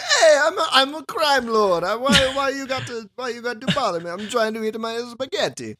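high, hammed-up Italian mobster accent; crime lord impression. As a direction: imitate a whiny accented crime boss pleading to be left alone. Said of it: "picture of you in my head".

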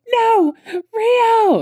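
very high, loud, drawn-out mock scream; hammed-up wail. Gemma calls after Rio as he leaves, hammed-up wail. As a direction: cry out after someone as if into the wind. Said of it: "the wind is stealing her voice".

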